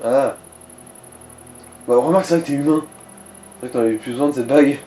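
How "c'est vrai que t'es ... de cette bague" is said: ordinary talk, nothing marked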